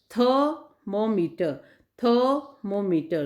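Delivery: "Thermometer" said slowly, stress after the r of "थर्"; stress demonstration. Shown as a demonstration. Teacher showing students where stress falls in thermometer. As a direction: draw out "thermometer" to show its stress. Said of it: "थ में र के बाद में stress लगाया".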